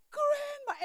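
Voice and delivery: imitating a child's high, terrified cry of grandma; retold scared scream. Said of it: "starts screaming and yelling".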